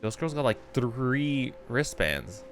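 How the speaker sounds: ordinary delivery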